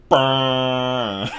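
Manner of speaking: long, loud imitated honk; foghorn nose-blow. Giant blowing his nose, loud foghorn honk. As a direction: blare it out like a foghorn. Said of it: "with a sound like a foghorn".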